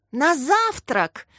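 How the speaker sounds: high, drawn-out cheerful call; joyful cry. A narrator's reading. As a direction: call out gleefully, stretching it. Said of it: "радостно закричали второклассники".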